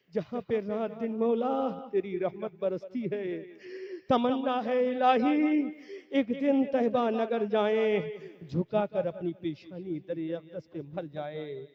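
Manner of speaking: high, loud devotional verse, settling at the end; verse recitation. Acted. Preacher quoting a poet's prayer for Medina, impassioned recitation. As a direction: declaim the couplet with soaring force.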